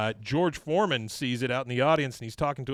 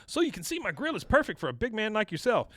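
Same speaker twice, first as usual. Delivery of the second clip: higher, eager grill-selling voice; salesman pitch; 7 semitones higher than usual. Retold or imitated. George Foreman selling a grill to a fan, eager higher pitch.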